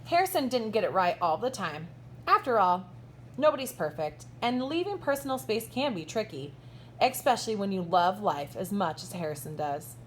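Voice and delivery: lively, bright storybook read-aloud with big swoops; reading to children. A narrator's reading. Teacher reading a picture book to her kindergartners, animated storybook voice. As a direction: read it like story time for little kids.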